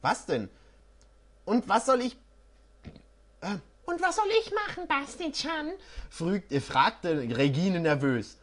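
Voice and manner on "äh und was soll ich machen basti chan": put-on much higher, cutesy girlish plea. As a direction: ask like a timid girl. Regine voice.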